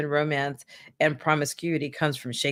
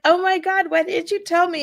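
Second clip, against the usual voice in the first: put-on much higher, gushing girl; high excited voice; 14 semitones higher, 6 dB louder than usual. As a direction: squeal it out, delighted. Lenina voiced in a retold scene.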